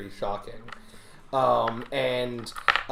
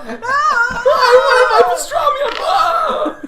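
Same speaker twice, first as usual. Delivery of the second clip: very high, loud sobbing shout; John wailing about his pastrami; 10 dB louder, slower than usual. Acted. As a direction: bawl like a thwarted child. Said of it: "That's his voice now".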